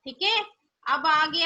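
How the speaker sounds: ordinary delivery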